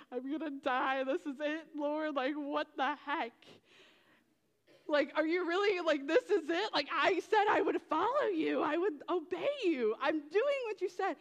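mock terror crying out to the Lord; frantic half-yelled plea; panicked prayer. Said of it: "Inside, I'm like".

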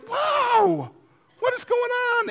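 very high-pitched astonishment; amazed. Acted. Runner stunned after the finish line, high astonished voice.

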